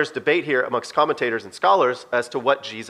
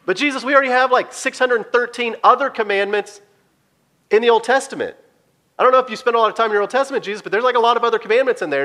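arguing disciple; 6 semitones higher than usual. Retold or imitated.

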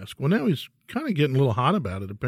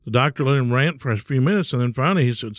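usual speaking voice

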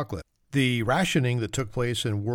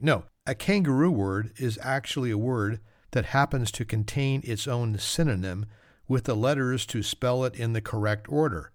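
ordinary talk

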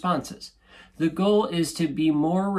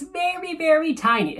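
very high, small voice for something tiny; tiny squeaky voice. Shown as a demonstration. Host showing how brief the pause is, tiny high voice. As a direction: squeeze it up high to sound minuscule.

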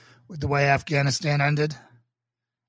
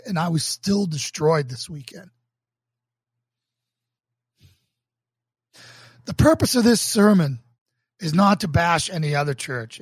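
earnest, emphatic sermon delivery, swelling louder on key phrases; sermon reading; 2 semitones higher, 4 dB louder than usual. Acted. Pastor reading his sermon to online viewers, earnest and emphatic. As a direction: preach it from the page with conviction.

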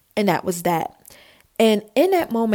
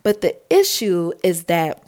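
usual speaking voice

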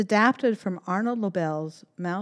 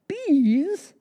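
gentle bear person; 10 semitones higher, 5 dB louder than usual. Acted. Bear calls bees.

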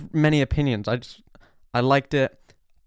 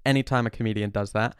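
usual speaking voice

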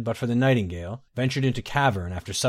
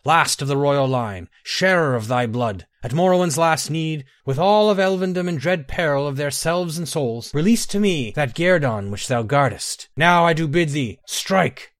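loud, high, ringing summons to a god; commanding invocation; 5 semitones higher, 4 dB louder than usual. A narrator's reading. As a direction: proclaim it like a summoning rite. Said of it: "the Nightingale cried".

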